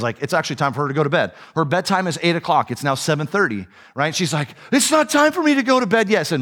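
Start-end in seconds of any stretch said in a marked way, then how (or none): 4.7-6.2 high, indignant imitation of a kid; protesting child. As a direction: argue against bedtime like an upset daughter. Acted daughter protesting early bedtime.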